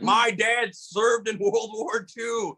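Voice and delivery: slow, high drunken mock holler; wasted mad heckler. Drunk giant protesting to the comic, slow high slurred outrage. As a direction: stretch it out like an indignant drunk. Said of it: "he's wasted. He's like".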